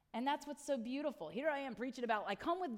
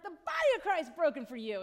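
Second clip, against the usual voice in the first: cheerful; 5 semitones higher, 10 dB louder than usual. Retold or imitated. Pastor giving communion very happily.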